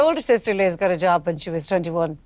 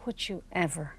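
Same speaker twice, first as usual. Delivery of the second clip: soft, falsely polite and hushed; mock sweet; 12 dB quieter, slower than usual. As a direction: say the rude thing as nicely as possible. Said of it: "as nicely as she could".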